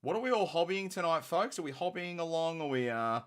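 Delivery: high, loud rallying call to the audience. Real, not acted. Host asking the chat about tonight's hobbying, calling out. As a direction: throw it out high and loud to everyone.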